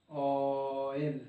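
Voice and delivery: "Oil" very slow, long low o before el; low, lingering ohhh-yel; stretched vowel. Shown as a demonstration. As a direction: draw out the start of "oil".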